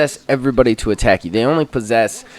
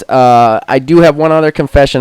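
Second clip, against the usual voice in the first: ordinary talk, nothing marked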